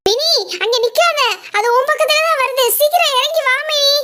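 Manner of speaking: a high, alarmed call across the room; urgent calling out. Acted. Teacher calls Mini down from the dragon, alarmed. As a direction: raise the pitch and call her down urgently.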